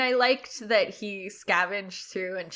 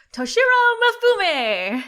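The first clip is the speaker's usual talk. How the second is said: super shouty, raised-pitch samurai shout; Toshiro Mifune; 13 semitones higher, 9 dB louder than usual. Acted. Co-host attempts an impression on request, shouting. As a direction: bellow the name like a shouty samurai. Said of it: "in your best Toshiro Mifune impression".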